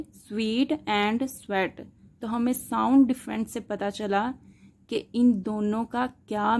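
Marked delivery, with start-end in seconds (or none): 0.0-0.7 स्वीट drawn out on its ee vowel; long ee in स्वीट. Shown as a demonstration. Teacher contrasting two word sounds, स्वीट with long ee. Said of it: "sounds different हैं दोनों के". 1.3-1.9 स्वेट set apart with its short e. Shown as a demonstration. Teacher contrasting two word sounds, स्वेट with short e. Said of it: "sounds different हैं दोनों के".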